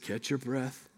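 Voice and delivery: put-on calm the winded boy down softly; gentle and soft. Dad soothing his winded son after the tree, softly. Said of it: "very compassionately, very gently".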